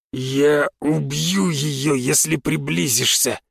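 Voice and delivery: slow menacing growl; growling threat. A narrator's reading. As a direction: threaten with a drunken snarl. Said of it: "прорычал он".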